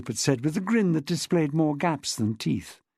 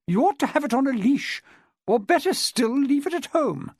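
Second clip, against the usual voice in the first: much higher, indignant scolding; 9 semitones higher than usual. Acted. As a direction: scold the dog owner. Chilmark scolding dog owner.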